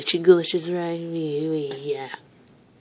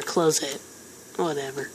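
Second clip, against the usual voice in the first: usual speaking voice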